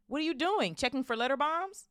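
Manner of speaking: high, loud calling out; shouting. A narrator's reading. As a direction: shout a mocking question to the next room. Vernon shouting from the kitchen.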